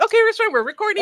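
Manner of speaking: high, loud, eager mock of a friend announcing a recording; imitating her friend. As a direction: chirp it like your overeager friend. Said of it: "The first thing you do when I meet you is like".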